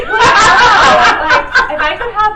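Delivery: loud, high laughing words. Real, not acted. Laughing loudly mid sentence.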